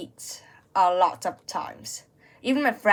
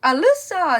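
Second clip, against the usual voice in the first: loud, high call of a student's name; teacher yelling a name; 8 semitones higher, 6 dB louder than usual. Retold or imitated. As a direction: shout the name across the classroom. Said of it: "she was yelling at everybody".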